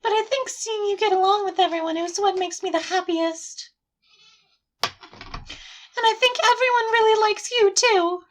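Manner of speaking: put-on high-pitched, affectionate game-girl voice; Sayori, sweet and friendly. Game girl glad of time with a club friend, high and sweet. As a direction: confide in a high girlish voice.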